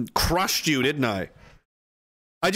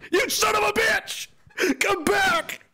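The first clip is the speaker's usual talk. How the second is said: very high, strained comic yell; mock anguished cry; slower than usual. Betrayed boyfriend calling Canada back, high mock anguish.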